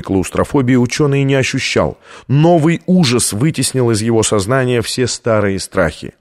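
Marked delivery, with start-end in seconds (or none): none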